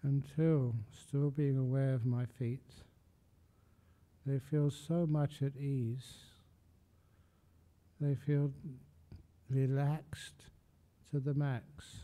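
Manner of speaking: soft, unhurried body scan with long pauses; guided meditation. Real, not acted. Meditation teacher talking listeners up from feet to legs, soft guided meditation.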